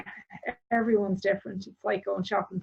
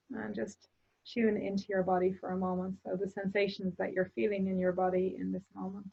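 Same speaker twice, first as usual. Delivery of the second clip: guided meditation. Acted. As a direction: guide the listener calmly into their body. Leads a closing meditation.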